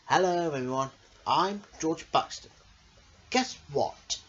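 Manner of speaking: ordinary delivery, nothing marked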